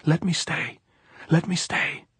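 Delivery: high, urgent pleading call; shouted plea. A narrator's reading. Maria begs to stay as the horses go up the draw, high pleading shout. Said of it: "Maria turned and shouted".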